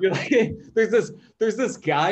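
loud and high, laughing through words. Real, not acted. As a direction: laugh out the setup. Laughing while describing alien view.

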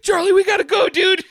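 mock panicked shout. Fleeing the mall chaos.

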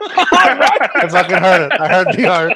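laughing through the words, high and loud; can barely get it out for laughing; laughing hard. Real, not acted. Laughing at his friend's rolled R in vodka, loud and high. Cracking up over the R.

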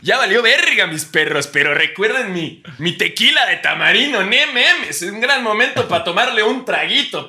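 high, loud hyped influencer voice; Luisito Comunica impression. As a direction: sell the tequila while crashing. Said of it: "Luisito Comunica del peligro". (imitando a Luisito Comunica, eufórico)

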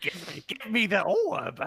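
high, slow cartoon henchman; a Disney villain's sidekick begging; dim henchman. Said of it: "sound like a henchman in a Disney film".

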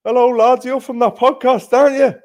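high, loud imitation of a stranger's shout; big high-pitched yell from a distance; shouting across the road. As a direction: holler it over to someone far away. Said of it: "guy shouts from across the road".

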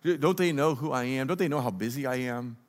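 quiet, grumbling mock CEO; mumbled under breath. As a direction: grumble it half to yourself. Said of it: "mumbles under his breath".

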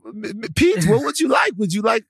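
a hammed-up Dutch waiter accent; mock Dutch accent voice. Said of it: "doing the Netherlands voice".

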